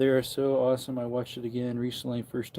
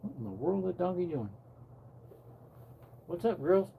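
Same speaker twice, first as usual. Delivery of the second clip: pet talk; 6 semitones higher, 5 dB quieter, faster than usual. Real, not acted. Talking to the dog and donkeys.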